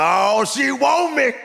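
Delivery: high, slow, loud dreamy gush; a man sure a glance means love; smitten man. Retold or imitated. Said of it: "we like".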